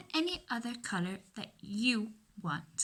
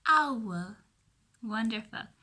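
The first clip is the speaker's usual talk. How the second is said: ordinary talk, nothing marked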